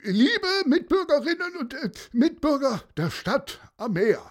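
mayor addressing the crowd, pompous and long-winded; mayor's public speech. Acted. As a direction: orate from the dike. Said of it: "und rief".

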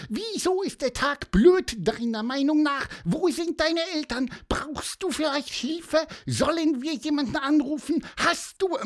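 very high squeaky stream of questions; eager bird interrogation. A narrator's reading. Pieper bombards the boy with questions, high eager chirp. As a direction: fire off questions in a tiny bird voice.

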